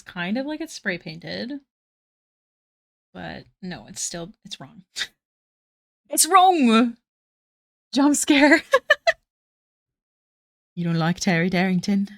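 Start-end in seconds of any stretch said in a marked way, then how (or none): none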